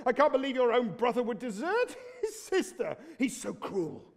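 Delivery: ordinary delivery, nothing marked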